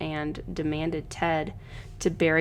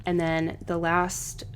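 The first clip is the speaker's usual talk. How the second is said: normal talking voice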